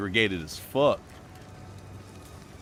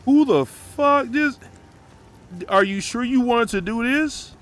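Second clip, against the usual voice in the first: high, loud and drawn-out, caught off guard; startled surprise; 12 semitones higher, 6 dB louder than usual. Real, not acted. Streamer startled by a surprise raid, high and loud. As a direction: react like someone just barged in.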